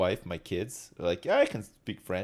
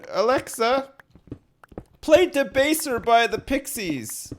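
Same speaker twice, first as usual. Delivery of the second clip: high, raised command to the device; calling out; 10 semitones higher, 3 dB louder than usual. Real, not acted. Man loudly telling Alexa to play Debaser. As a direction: call across the room.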